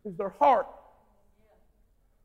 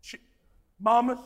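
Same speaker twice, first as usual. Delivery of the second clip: normal talking voice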